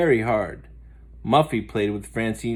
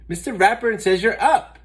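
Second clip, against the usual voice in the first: urgent; 10 semitones higher, 6 dB louder than usual. Acted. Muffy pleads.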